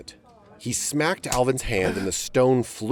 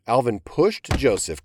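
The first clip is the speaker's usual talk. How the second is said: usual speaking voice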